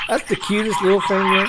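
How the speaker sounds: cooing. Real, not acted. Cooing at a cute map icon.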